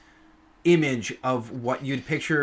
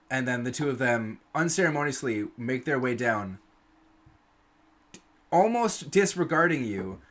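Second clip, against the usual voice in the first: normal talking voice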